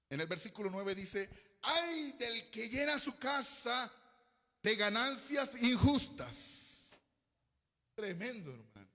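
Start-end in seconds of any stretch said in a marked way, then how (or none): 1.6-7.0 high, loud, slow proclamation of woe; exclaimed scripture reading. Real, not acted. Preacher reads verse nine to the church, loud drawn-out warning. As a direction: cry out the warning with stretched words.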